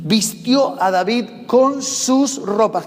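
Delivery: slow, deliberate reading of the verse; scripture reading. Shown as a demonstration. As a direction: read each word of the verse aloud, solemnly. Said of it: "Dice el versículo treinta y ocho".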